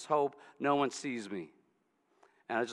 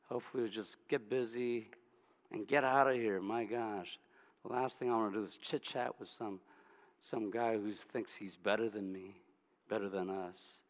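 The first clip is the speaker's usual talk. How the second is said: quiet grumbling; 6 semitones lower, 7 dB quieter than usual. Acted. Woman's grumbling inner thoughts.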